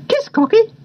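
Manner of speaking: high talking-parrot voice; old cockatoo. A narrator's reading. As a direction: squawk it like a pet cockatoo. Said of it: "said old Mister Cockatoo".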